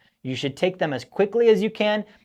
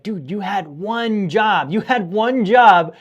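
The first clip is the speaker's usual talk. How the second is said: frustrated rant; 3 semitones higher, 5 dB louder than usual. Retold or imitated. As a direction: drag out each word at the idiot in traffic. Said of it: "I get so frustrated. I'm like".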